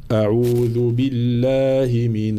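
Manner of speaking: slow chanted recitation; Quran recitation. Shown as a demonstration. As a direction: intone it slowly for students to repeat. Teacher recites for repetition.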